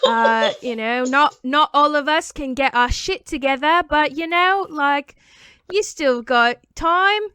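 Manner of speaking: slow, drawn-out mock-British encouragement. Pretend singer tells fan there is still time, mock British. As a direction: encourage patronizingly in a stretched British accent. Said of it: "that's what [name] would have said".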